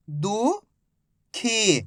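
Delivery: दुखी drawn out slowly as दु... खी; stretched syllables. Shown as a demonstration. Teacher to pupils, stretching दुखी slowly. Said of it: "slow syllable reading".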